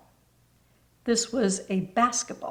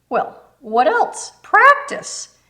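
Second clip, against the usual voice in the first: higher and louder on Practice, teasing; playful punchline; 10 semitones higher, 9 dB louder, slower than usual. Acted. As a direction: land the joke with a bright lift.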